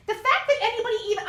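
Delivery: sudden fast, high indignant burst; getting mad. Real, not acted. Breaking off the article to object, getting mad. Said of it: "I'm sorry, I'm getting mad".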